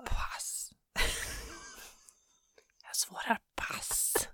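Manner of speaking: tiny whispered cop-out; hushed mock evasion; joking whisper. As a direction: whisper it as if ducking the question. Joking reply to mortgage question.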